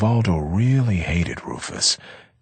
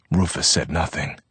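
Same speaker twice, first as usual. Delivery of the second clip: usual speaking voice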